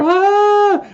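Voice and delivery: shrill loud scream, very high; high piercing scream. Retold or imitated. Final girl frozen before Jason, very high loud scream. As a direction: let out the final girl's scream. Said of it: "sits there screaming and screaming".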